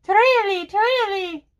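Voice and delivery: high trilled bird call, "tu-ree-lee"; birdsong interpretation. Shown as a demonstration. Offering an interpretation of the German bird, high birdsong. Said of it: "this is my interpretation".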